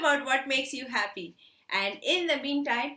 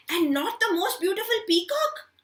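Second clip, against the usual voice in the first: astonished; 7 semitones higher, 4 dB louder than usual. Acted. Crow's astonished question.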